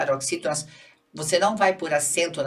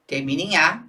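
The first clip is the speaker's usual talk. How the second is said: ordinary talk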